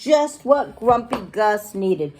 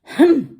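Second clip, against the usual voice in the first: short, loud, high grumbling hum; grumpy "hmph"; 7 semitones higher, 5 dB louder than usual. A narrator's reading. Pirate grouching in his own way, grumpy loud hum. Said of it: "He grumbles and he grouches".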